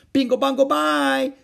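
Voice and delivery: very high, loud chant; shouted. A narrator's reading. Howie shouting nonsense to make noise. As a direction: yell nonsense just for noise. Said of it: "shouted the others".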